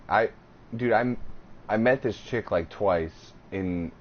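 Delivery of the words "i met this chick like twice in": hiccuping while talking; words broken by hiccups. Real, not acted. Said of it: "sorry, I'm dealing with the hiccups".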